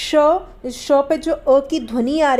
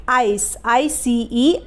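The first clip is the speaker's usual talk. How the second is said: slow spelling; much slower than usual. Shown as a demonstration. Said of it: "teacher spells example word".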